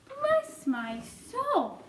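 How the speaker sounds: very high, drawn-out exclamation; astonished cry. A narrator's reading. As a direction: gasp it out in amazement. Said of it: "cried the head of the fire department".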